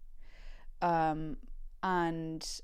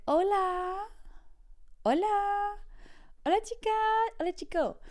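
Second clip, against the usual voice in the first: calling out; 13 semitones higher than usual. Retold or imitated. Desperate kid hailing the other teacher, high singsong. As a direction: call over in a squeaky mock-friendly voice.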